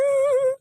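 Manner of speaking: short, high cackle; teasing laugh. Acted. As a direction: burst out at your own joke. Laughs at own joke. (छोटी, ऊँची हँसी)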